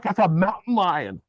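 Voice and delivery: high, slow incredulous protest; retold alarm. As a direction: re-enact disbelief, pitched high and drawn out. Retelling alarm at mountain lion.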